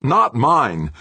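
high, loud denial; insistent. A narrator's reading. Engineer denying responsibility to the professor, loudly insistent. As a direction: fling the blame away. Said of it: "Obermann insisted".

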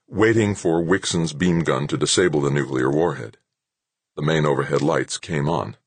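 plain reading voice

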